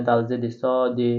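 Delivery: ordinary talk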